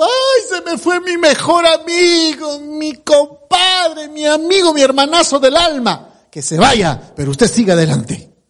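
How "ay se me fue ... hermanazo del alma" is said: hammed-up crying over a lost compadre; mock lament. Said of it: "Vamos a estar llorando".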